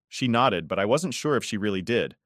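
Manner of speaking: ordinary delivery, nothing marked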